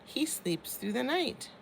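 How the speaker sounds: ordinary delivery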